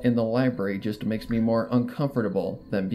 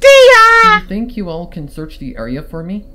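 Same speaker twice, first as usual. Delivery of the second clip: higher, much louder, strained ask; eager plea; 8 semitones higher, 14 dB louder than usual. Acted. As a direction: blurt out the idea. Sudden idea, urgent request.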